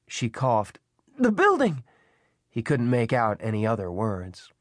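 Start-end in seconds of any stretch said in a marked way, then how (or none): 1.2-1.8 high woman's questioning voice; Eddie calling. A narrator's reading. Elderly woman asking man about the fire, calling out. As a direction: finish the question high and urgent.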